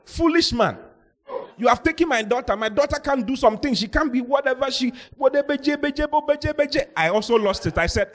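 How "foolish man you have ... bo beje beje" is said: high, scolding mother on the phone; high mock angry mother; insulting mother. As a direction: mimic her ranting insults at the pastor. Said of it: "she was just insulting me on the phone".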